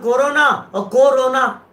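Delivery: कोरोना said slowly and distinctly each time; careful spelling-out. Shown as a demonstration. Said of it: "तीन शब्द हैं". (कोरोना धीरे और साफ़ बोलें)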